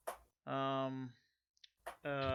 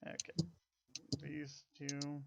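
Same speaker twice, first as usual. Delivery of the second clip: ordinary delivery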